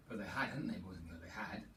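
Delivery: secret whisper to the class. Real, not acted. Teacher tells the children a secret, whispering. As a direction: lean in and whisper. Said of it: "teacher whispers aside to class".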